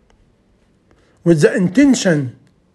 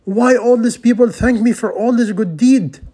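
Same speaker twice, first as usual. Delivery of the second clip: higher, pleading question to God; a man crying out to Allah, higher and pleading; 6 semitones higher than usual. Retold or imitated. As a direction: plead upward in bewilderment. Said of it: "He cried to Allah".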